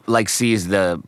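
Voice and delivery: normal talking voice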